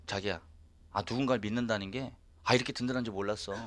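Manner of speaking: 조용하고 부드럽게 아내 흉내; 속삭이듯 다정하게 털어놓기; 다정한 고백 흉내. Retold or imitated. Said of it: "나한테 딱 뭐라고 했냐면". (남편에게 든든하다고 고백하는 아내의 부드러운 목소리)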